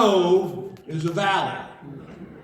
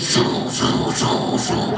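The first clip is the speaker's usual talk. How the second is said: repeated breathy whoosh noises; whooshing plane rolls; much slower than usual. Retold or imitated. Pilot cranking the plane over, imitated as whooshes. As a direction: imitate each roll with a voiceless whoosh. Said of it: "He goes".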